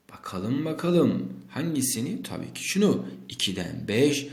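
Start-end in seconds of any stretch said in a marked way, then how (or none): 3.3-4.3 slow teaching recap. Shown as a demonstration.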